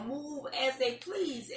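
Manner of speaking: normal talking voice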